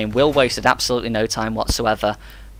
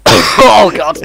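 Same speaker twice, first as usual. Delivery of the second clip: strained, cracking, higher and louder; hoarse after coughing; 7 semitones higher, 11 dB louder than usual. Real, not acted. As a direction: croak it out after a coughing fit. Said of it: "I actually can't speak".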